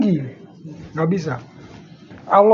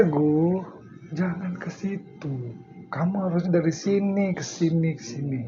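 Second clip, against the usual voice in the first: softer, gentle guiding teacher voice; 4 semitones lower, 11 dB quieter than usual. Retold or imitated. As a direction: quietly show the student the way. (guru membimbing dengan suara pelan)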